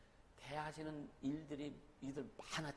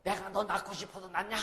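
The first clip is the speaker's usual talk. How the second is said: mother's retort; 4 semitones higher, 6 dB louder than usual. Retold or imitated. Mother retorts to her child.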